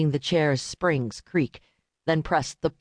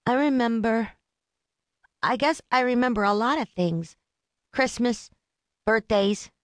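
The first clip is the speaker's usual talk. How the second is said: put-on high, reedy little boy; young boy voice; 6 semitones higher than usual. A narrator's reading. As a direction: pitch up into a small boy. Boy answers the prosecutor.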